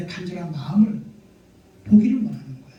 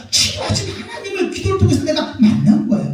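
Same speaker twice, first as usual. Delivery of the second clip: high, impassioned pulpit crescendo; rousing preacher's cry; fervent preaching; 5 semitones higher, 4 dB louder than usual. Real, not acted. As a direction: proclaim it with mounting force. Preaches at full force.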